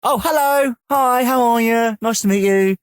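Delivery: high, drawn-out gushing hello; imitated delighted meet-and-greet; starstruck greeting. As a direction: act out fawning over someone famous. Switch from apology to greeting a star.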